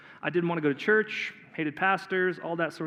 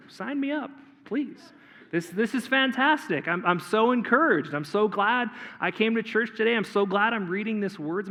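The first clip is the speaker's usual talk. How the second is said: sarcastic enthusiasm; 3 semitones higher than usual. Retold or imitated.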